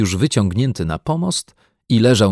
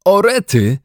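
dismayed; 11 semitones higher, 6 dB louder than usual. Acted. Boy's dismayed exclamation.

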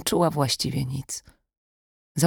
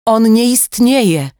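higher, louder, slow outburst; shout; 8 semitones higher, 8 dB louder, slower than usual. Acted. Sasza shouting at the kidnapper on the phone, slow and loud. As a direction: cry out in disbelief. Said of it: "krzyknęła Sasza".

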